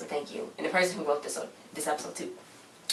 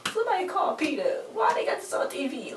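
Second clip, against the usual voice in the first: very high-pitched appalled wail; hammed-up dismay, pitched way up; mock distress; 9 semitones higher than usual. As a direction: plead for help in a squeaky high voice. Mock outrage at the shot dog.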